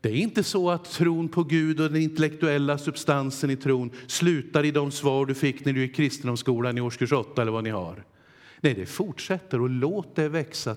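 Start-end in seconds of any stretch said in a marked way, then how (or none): none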